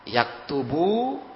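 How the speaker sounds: "Yaktubu" drawn out slowly, syllable by syllable; slow Arabic demo. Shown as a demonstration. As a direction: spell out "Yaktubu" slowly for the class. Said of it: "untuk laki-laki". ("Yaktubu" diucapkan pelan, suku demi suku)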